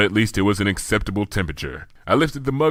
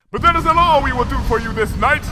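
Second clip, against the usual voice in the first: higher, louder royal bellow; 5 dB louder than usual. A narrator's reading. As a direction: knock them over with the voice. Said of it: "bellowed Luna".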